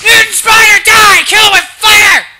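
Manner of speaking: high, full-blast screaming; panicked yelling. Real, not acted. Player meeting a mutant spider, panicked yelling. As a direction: scream in horror, right into the mic. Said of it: "I gotta stop yelling".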